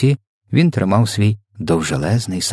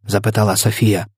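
usual speaking voice

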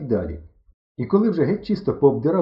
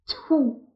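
high, short "tfu"; annoyed spit. A narrator's reading. Зіткнулися, мало не розминулись. (роздратоване «тьфу»)

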